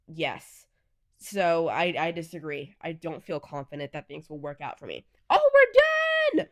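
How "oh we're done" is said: high, loud, slow sung-out cheer; much higher, drawn-out singing cheer; excited sing-song. Real, not acted. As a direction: sing it out, thrilled to be done. Celebrates finishing the quiz.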